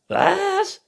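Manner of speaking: very high, loud „Was?“; Waschbär. A narrator's reading. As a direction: squeal it as the raccoon. Raccoon figure, shrill question. (hoher, lauter Waschbär-Schrei)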